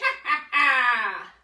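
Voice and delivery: loud, cackling witch laugh; witch cackle. Shown as a demonstration. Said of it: "we can cackle like a witch".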